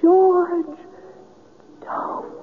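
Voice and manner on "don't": an unvoiced breath of a word; whispered plea. [name] whispering to George to stop. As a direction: whisper a desperate don't.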